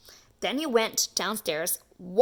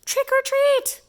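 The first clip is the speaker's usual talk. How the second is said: much higher and louder call; a little louder; 6 dB louder than usual. Acted. Lance calling trick or treat louder after no answer. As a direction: call it out into the house. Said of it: "He said a little louder".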